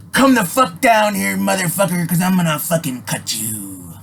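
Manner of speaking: imitating a thug's taunt; retold threat. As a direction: throw down a challenge with a knife in hand. Man with knife threatens narrator.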